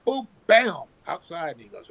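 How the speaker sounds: normal talking voice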